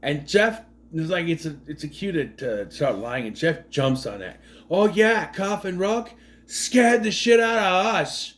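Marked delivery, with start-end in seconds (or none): 4.7-8.3 lightly imitated Boston-New York accent, slow on the second line; a hint of a Boston tough guy; mock Boston accent. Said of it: "I don't know what accent he's trying to do".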